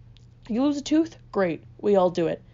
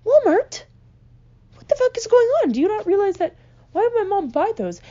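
high, loud disbelief; incredulous outrage; 10 semitones higher, 5 dB louder than usual. Acted. Comic telling the crowd about fake Santa glasses, incredulous. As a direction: blurt it out in exasperated disbelief.

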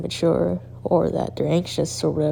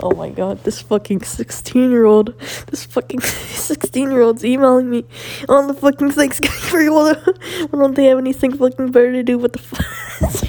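laughing mock teacher; 7 semitones higher, 7 dB louder than usual. Mock teacher reacting to email.

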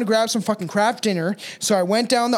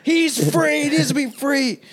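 mock juror plea; 7 semitones higher than usual. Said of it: "It's like a child murderer, but".

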